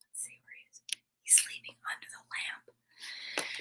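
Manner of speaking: soft hushed whisper. Real, not acted. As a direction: whisper so the dog sleeps. Whispering so sleeping dog rests.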